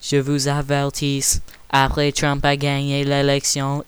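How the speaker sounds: low, even robot voice. Acted. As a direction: speak like a robot. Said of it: "je suis un robot du futur".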